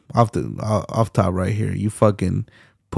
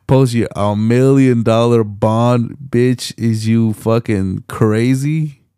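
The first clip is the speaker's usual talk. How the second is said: loud, drawn-out disbelief; incredulous outburst; 5 dB louder, slower than usual. Real, not acted. Streamer scolding a woman for posting bond, loudly incredulous. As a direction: stretch the words out in loud exasperation.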